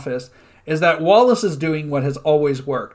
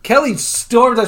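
ordinary talk

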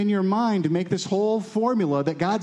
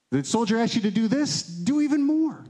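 rising exhortation; 8 semitones higher than usual. Retold or imitated. Prophet instructing his hearers, high insistent exhortation. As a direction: pitch climbing high and slowing at the end.

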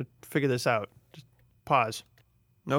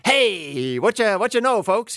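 much higher and louder hammed-up greeting; big mock showbiz hello; vaudeville showman; 7 semitones higher, 4 dB louder than usual. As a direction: sell it like a jazzy stage host. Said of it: "doing kind of a vaudeville jazzy, like".

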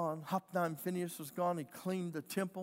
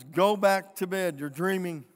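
dismissive order; 10 dB louder than usual. Acted. Priest dismisses the woken boy.

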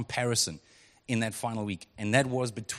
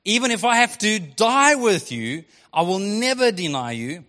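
insisting vow; 6 semitones higher, 7 dB louder than usual. Retold or imitated. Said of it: "Peter insisted".

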